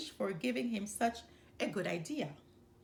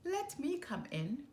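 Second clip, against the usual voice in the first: high, sing-song wolf call; sly wolf coaxing at the door; wolf calling sweetly; 9 semitones higher than usual. A narrator's reading. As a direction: coax the pig to open up in a high lilting voice. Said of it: "the wolf said".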